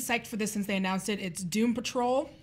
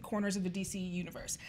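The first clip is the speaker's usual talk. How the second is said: whispering; 3 semitones lower, 8 dB quieter, faster than usual. Acted. Whispered end of synopsis.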